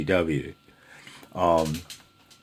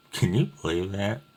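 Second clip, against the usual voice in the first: ordinary delivery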